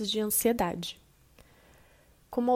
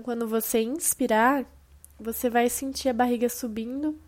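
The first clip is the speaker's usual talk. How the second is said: ordinary delivery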